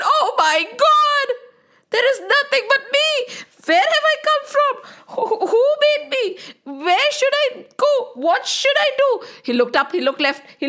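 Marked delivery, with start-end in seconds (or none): 0.0-9.3 panicked cries. Acted. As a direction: cry out lost and scared. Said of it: "he screamed".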